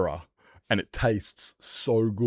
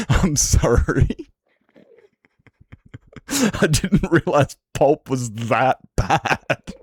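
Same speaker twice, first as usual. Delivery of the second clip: louder and slower, breaking up with laughter; laughing; 6 semitones higher, 6 dB louder, much slower than usual. Real, not acted. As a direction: apologise through real laughter. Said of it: "And I'm just pissing myself".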